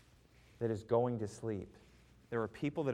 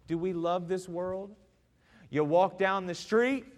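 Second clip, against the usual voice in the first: normal talking voice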